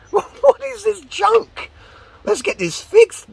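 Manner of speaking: very high squeal of disbelief; hammed-up high-pitched dismay at a wreck; mock outrage. Acts out reaction to broken bicycle.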